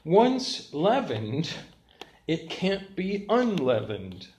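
each word laid down slowly; preacher pausing over "Once leavened"; slow, deliberate statement. Shown as a demonstration. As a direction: spell out the point word by word. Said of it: "The third point is this".

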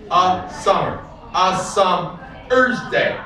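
slow, stressing shall, compare and summer's; counting the beats. Shown as a demonstration. Teacher counting beats of the sonnet line. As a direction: tap out each iambic beat. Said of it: "Two is one beat".

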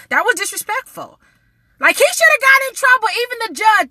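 high-pitched, heated outrage; indignant. Real, not acted. Vlogger demanding judge and police be punished, indignant rant. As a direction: push the pitch up and protest hard.

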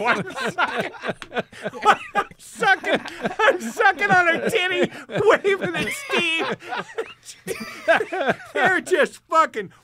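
loud, high, real laughing delivery; laughing hard. Real, not acted. Comic telling friends his wild bar story, cracking up. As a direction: push the words out high through laughter.

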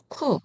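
쿠 short and high with a puff; aspirated 쿠. Shown as a demonstration. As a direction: release the k of 쿠 with air. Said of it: "발음하시면 되고요". (거센소리로 짧게 쿠)

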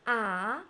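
"Ah" swooping upward; second tone. Shown as a demonstration. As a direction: lift "Ah" like a surprised question.

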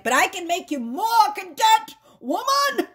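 hammed-up high, loud pleading; insistent masculine. Masculine begging to give content woman the world, hammed-up insistence. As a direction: mock-desperate overblown protest, pitch shooting up. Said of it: "And he's like".

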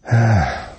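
loud, high falling sigh; weary sigh. A narrator's reading. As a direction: let out a bored, heavy sigh. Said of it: "sóhajtotta végül Fábiánné". (unott, nagy sóhaj)

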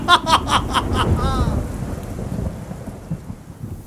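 soft, smug villain laugh; a quiet, self-satisfied cackle; gloating laugh. Acted. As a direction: laugh at them from far away. Villain gloating over his betrayal.

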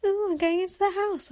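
high, put-on mocking voice; mocking Emma. Viewer sneering at Emma entering the house, high mocking voice. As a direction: mimic her in a mock-excited high pitch. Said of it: "She was like".

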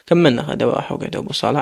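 plain reading voice

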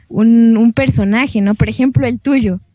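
normal talking voice

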